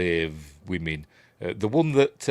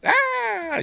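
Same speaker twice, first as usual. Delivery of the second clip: mock scream. Mimicking the stabbing scream.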